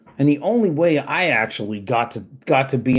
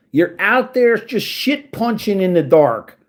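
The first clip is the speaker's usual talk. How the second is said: higher, louder scornful jab; angry rant; 6 semitones higher, 4 dB louder than usual. Real, not acted. Ranting at troll Dylan, higher, louder scornful jab. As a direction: scold him like he's right there.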